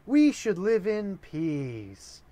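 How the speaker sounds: mock-innocent high voice calling for peace; pleading sister. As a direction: beg him to stop in a hippie peace tone. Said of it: "pulls the hippie dressed son off of the father and says".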